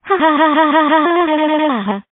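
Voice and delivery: rapid-fire, artificial "ha-ha" laugh; mock scheming laugh. Prankster gloating over planned prank.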